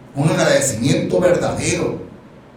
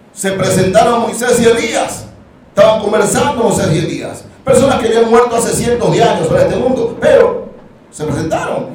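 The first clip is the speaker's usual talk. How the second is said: higher and louder, driving the story; preaching at full force; 5 semitones higher, 4 dB louder than usual. Acted. Preacher retelling the Transfiguration to the church, fervent. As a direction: build it up with fervour.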